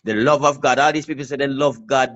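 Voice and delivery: ordinary talk, nothing marked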